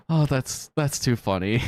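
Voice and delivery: usual speaking voice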